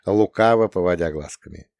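usual speaking voice

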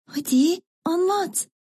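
high, drawn-out impatient plea; impatient little sister, pitched high. A narrator's reading. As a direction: urge her to tell, impatiently. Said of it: "Sonra küçük olan sabırsızlıkla".

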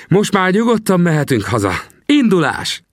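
put-on high, glad cry of an old trapper; joyful shout. Little Sam gladly calls out to his friend, high and joyful. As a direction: cry out in delight.

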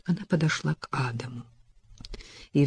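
ordinary delivery, nothing marked

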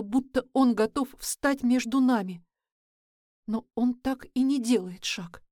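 usual speaking voice

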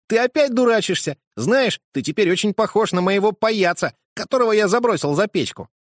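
put-on high, loud voice of a teasing boy; cheeky boy. Boy Fritz teasing godfather.

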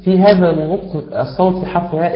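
usual speaking voice

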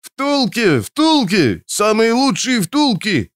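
high, drawn-out hawker's cry; whining street vendor. A narrator's reading. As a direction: whine out a sales pitch in a high voice. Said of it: "нудил какой-то торговец".

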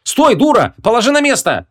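high, urgent cry; alarmed order. Acted. Young woman stopping the princess, urgent cry. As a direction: shout her to a stop, pitch leaping up. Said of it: "но тут же спохватилась".

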